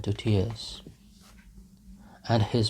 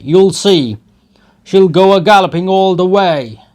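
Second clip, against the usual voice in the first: loud, higher bragging rant; drunken boastful shouting; 7 semitones higher, 13 dB louder than usual. Acted. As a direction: brag and bellow at the lads. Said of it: "he shouted from the cart".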